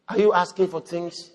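normal talking voice

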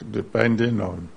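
ordinary talk, nothing marked

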